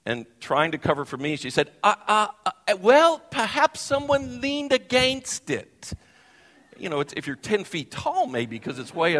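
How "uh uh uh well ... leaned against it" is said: put-on hesitant, high, slow South African accent. Host covering for the speaker with guards, in a high hesitant South African accent. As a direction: stammer then innocently suggest it in a South African accent. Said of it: "so sweet in her South African accent".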